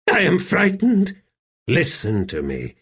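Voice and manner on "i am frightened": high-pitched timid confession; high, fearful servant voice; frightened servant. A narrator's reading. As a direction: admit your fear to a fellow slave. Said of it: "Terrified eyes glistened up at him".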